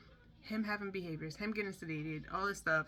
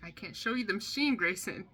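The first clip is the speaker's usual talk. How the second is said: exasperated mom; 2 semitones higher, 7 dB louder than usual. Retold or imitated. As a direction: raise your voice over his meltdown. Son in full meltdown.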